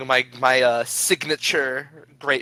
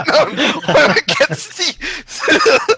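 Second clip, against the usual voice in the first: much higher, louder, drawn-out laughing wail; laughing shriek; 11 dB louder than usual. Acted. Player repeats Paulson's spiteful line, shrieking with laughter. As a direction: stretch it out in a hammed-up screech through laughter.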